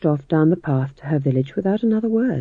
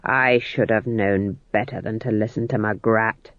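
lower, softer menacing growl; 6 semitones lower, 3 dB quieter than usual. Acted. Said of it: "growled Nanny".

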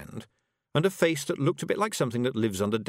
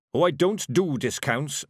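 lofty dwarf, nose in the air; 5 semitones higher, 5 dB louder than usual. Acted. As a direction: look down on her from a great height. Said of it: "as loftily as a dwarf can manage".

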